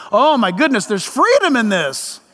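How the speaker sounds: normal talking voice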